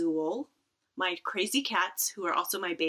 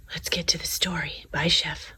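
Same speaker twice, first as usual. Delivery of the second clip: whisper; 2 semitones lower, 3 dB louder than usual. Real, not acted. Librarian says goodbye to the sleeping cat, whispering. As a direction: slip away from the sleeping cat.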